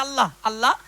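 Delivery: loud, declaimed cry to Allah; acted desperate plea. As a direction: call out to God as the repentant drunkard. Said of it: "ধুলোয় পড়ে গড়াগড়ি খাচ্ছে". (আল্লাহর কাছে জোরে আকুতি)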